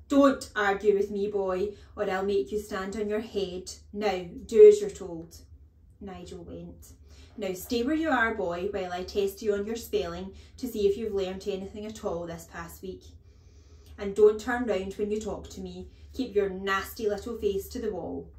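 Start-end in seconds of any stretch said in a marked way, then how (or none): 0.1-5.4 very loud threat; fierce, commanding telling-off; bullying headmistress. A narrator's reading. Headmistress silences Nigel. 7.4-18.3 loud, bullying orders; harsh schoolroom commands; domineering headmistress. A narrator's reading.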